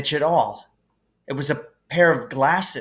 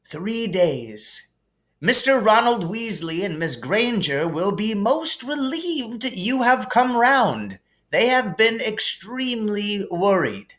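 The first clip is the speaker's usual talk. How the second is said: higher, gently amused headmaster voice; kindly old headmaster; 8 semitones higher than usual. Acted. Dumbledore calming Harry in the hospital wing, gently amused. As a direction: soothe the boy like a kindly wise old wizard.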